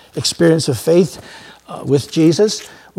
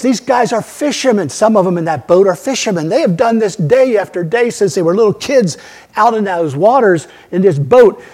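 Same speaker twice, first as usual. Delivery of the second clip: ordinary talk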